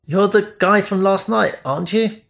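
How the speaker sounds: a lightly voiced young man, recognising someone; hint of surprised recognition; curious stranger.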